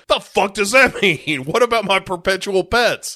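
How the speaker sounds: lightly voiced annoyed boss; mock angry boss. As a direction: whine it like a petulant boss. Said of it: "he's like".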